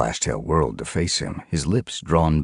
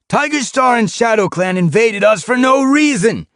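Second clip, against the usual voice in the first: much higher, louder rallying call; raised voice; 9 dB louder than usual. A narrator's reading. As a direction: project over the whole clearing. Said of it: "raised his voice to be heard across the clearing".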